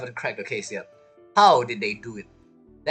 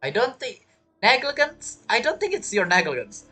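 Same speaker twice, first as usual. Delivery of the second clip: higher-pitched puzzled objection; disbelieving; 7 semitones higher than usual. Real, not acted. Game viewer disputing the negligence admission, disbelieving. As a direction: push back in surprise, pitch raised.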